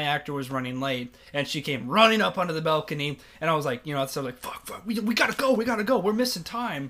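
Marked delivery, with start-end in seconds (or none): 4.4-6.9 higher-pitched, urgent retold panic; mock-panicked hurry; panicked urging. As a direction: act out being frantic to get moving. Retelling rush to get going.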